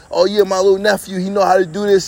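usual speaking voice

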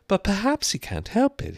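high-pitched Alice voice; high, musing character voice; Alice to herself. A narrator's reading. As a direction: reason it out in a high girl's voice. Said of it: "he said to herself".

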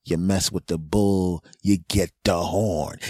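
ordinary delivery

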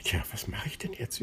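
fast, soft musing whisper; pondering whisper. A narrator's reading. Alone on the stone Plitsch wonders, whispering quickly. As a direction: wonder aloud to yourself. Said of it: "überlegte er".

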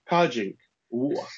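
slow, drawn-out Cockney repeat; slowed right down for mum; said slowly. Retold or imitated. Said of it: "Say it slowly".

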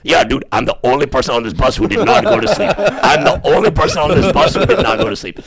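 mocking Akbar. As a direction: parrot a smug friend while cracking up. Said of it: "Akbar wakes up".

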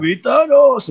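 loud, high heroic yell; Thor's battle cry. Acted. Thor demanding his enemy in Infinity War, shouted battle cry. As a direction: shout it like a warrior charging in. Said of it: "they went with a serious route".

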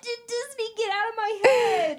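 high, loud, laughing disbelief; mock outrage. Writer seeing Brave on screen, high laughing disbelief. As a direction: squeal it like your idea got stolen. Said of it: "and I'm like".

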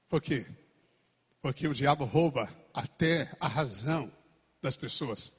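ordinary talk, nothing marked

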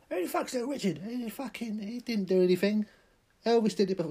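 high, loud put-on rant; high mock indignant tirade; mocking ranter. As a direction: ham up an outraged bloke. Mocked angry voice.

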